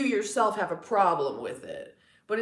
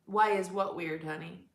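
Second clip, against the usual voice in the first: ordinary talk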